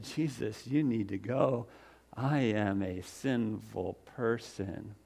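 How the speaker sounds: soft, slow, pleading; hushed plea. Acted. Ashamed fisherman quietly pleading with Jesus to leave. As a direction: beg him gently to go away. Peter again begging Jesus.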